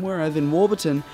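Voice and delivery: ordinary delivery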